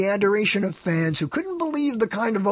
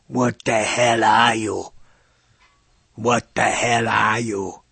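put-on slow, lower Austrian-accented Arnold; Arnold Schwarzenegger impression; 6 semitones lower, slower than usual. Host doing his Arnold line from Predator, slow Austrian accent. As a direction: growl it out slowly like Arnold in Predator. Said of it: "he goes".

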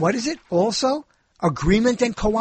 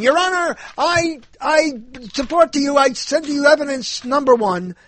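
lightly played lawyer, pitched higher; higher-pitched mock legal address; mock courtroom lawyer; 6 semitones higher than usual. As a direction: plead a mock case to the judge.

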